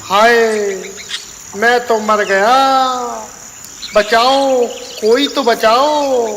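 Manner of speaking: high panicked cries for help; burning man screaming. Acted.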